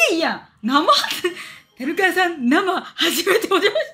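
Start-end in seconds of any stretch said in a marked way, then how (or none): 1.8-3.9 slow, higher, leering mock voice breaking into laughter; slow laughing mock fan greeting; creepy read-aloud. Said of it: "キモい。".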